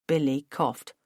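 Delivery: ordinary delivery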